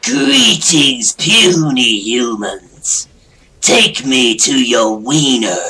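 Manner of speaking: hammed-up alien conqueror, very slow; booming arrogant alien overlord. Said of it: "That's what happens when aliens".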